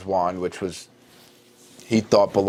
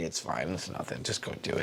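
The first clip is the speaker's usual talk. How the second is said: soft, lower, breathless hush; ghostly whisper; 6 semitones lower, 13 dB quieter than usual. Acted. Harry's dead parents reassure him about dying, in a ghostly whisper.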